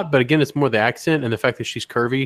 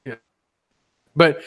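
usual speaking voice

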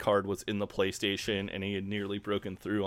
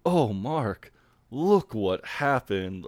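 mock surprise; 3 dB louder, much slower than usual. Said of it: "Jeremy just acts so surprised where he's like".

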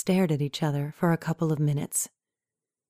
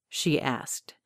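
plain reading voice

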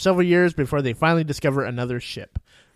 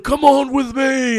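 mock rescuer; 10 semitones higher, 6 dB louder, slower than usual. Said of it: "he's like".

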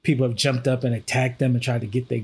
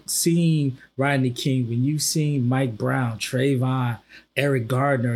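usual speaking voice

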